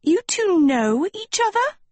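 very high, astonished question; high-pitched stunned girl; gaping surprise. A narrator's reading. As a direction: squeak it in disbelief. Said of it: "Sophie gaped at them".

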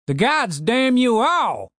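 put-on slow, high cranky old man yelling; shouting old man. A narrator's reading. Old man cursing the visitors for his gardener, slow angry shout. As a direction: bellow at the intruders like a furious old man. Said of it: "shouted the man".

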